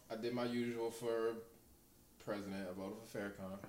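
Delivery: ordinary talk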